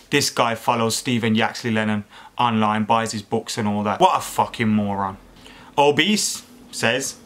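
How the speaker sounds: ordinary talk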